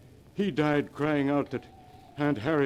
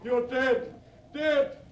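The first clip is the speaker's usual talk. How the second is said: going wilder with every word; growing wild with terror; 11 semitones higher than usual. Acted. Uncle raves at his dead brother's ghost at midnight, frantic. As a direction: pitch climbing high, frantic and unhinged. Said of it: "voice grew wilder and wilder".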